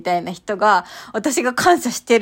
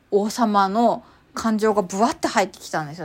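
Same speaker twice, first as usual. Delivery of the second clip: normal talking voice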